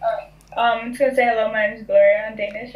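normal talking voice

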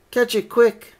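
very high, loud command; urgent shout. A narrator's reading. Nicky wants the escaping kitten caught, shouting urgently. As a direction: yell it as an urgent order. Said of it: "shouted Nicky".

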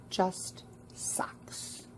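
hushed secret aside; whisper. A narrator's reading. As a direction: whisper the joke.

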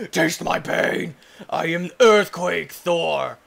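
slow, booming mock-heroic villain voice. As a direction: proclaim it like a silly comic-book god. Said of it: "I'm like".

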